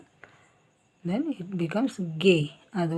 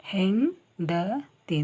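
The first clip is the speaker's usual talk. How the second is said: slow demo; 2 semitones higher, much slower than usual. Shown as a demonstration. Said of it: "this is called ಸೊನ್ನೆ".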